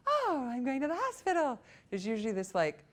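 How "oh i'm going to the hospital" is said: mock cheerful. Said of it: "completely like".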